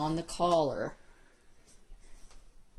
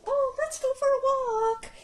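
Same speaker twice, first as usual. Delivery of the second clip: much higher, louder gushing excitement; excited dog-owner voice; 8 dB louder than usual. Retold or imitated. Owner hyping up the dog before a walk, high and gushing. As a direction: hype the dog up like an eager owner. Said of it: "we sometimes go".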